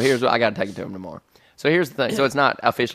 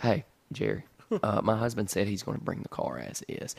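soft, lower, halting phone voice; imitating timid wife; 5 semitones lower, 10 dB quieter than usual. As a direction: say it softly and reluctantly, as the wife. Voicing wife's timid phone call.

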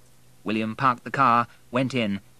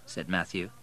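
plain reading voice

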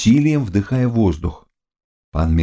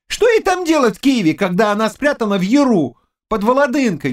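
high-pitched, excitable youth; agitated young servant. Acted. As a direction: protest in a high, boyish servant voice. (взволнованный юный слуга высоким голосом)

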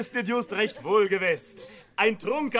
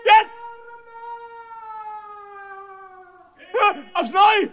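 terrified outcry; 9 semitones higher than usual. Acted. Jedermann terrified by the calls.

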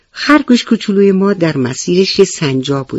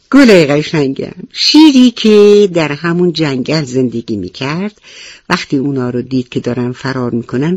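ordinary delivery, nothing marked

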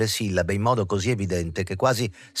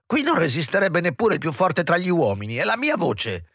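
fussy aging actress; 7 semitones higher than usual. A narrator's reading. Moz voiced as character.

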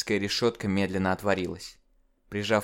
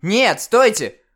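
очень громко, высоко и медленно; кричи, чтобы не ушла; отчаянный крик; 12 semitones higher, 10 dB louder, much slower than usual. Acted. (отчаянный крик)

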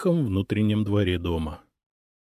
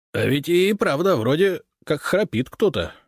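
policeman's voice, higher, louder, in mild surprise; a burly constable just noticing the snoring, a bit taken aback; surprised; 8 semitones higher, 3 dB louder than usual. A narrator's reading. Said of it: "удивлённо ответил городовой". (удивлённо, голосом городового)

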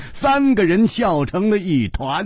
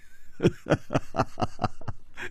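soft laugh; 6 semitones lower, 10 dB quieter than usual. Acted. Chu laughs at Hu.

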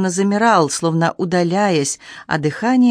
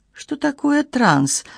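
slow, higher-pitched innocent question; lightly acted wide-eyed innocence, pitched up; 8 semitones higher, much slower than usual. A narrator's reading. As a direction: ask it slowly, as if you truly had no idea. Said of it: "совершенно невинным тоном спросила я".

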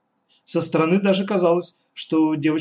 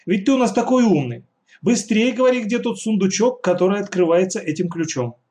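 put-on eager girl; 6 semitones higher than usual. A narrator's reading. Katya urging Yura.

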